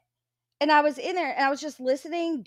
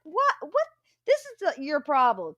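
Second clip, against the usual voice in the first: much higher, incredulous What? What?; retold disbelief; 5 semitones higher than usual. Blocked viewer to Jeanette, incredulous high squeak. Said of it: "I'm like".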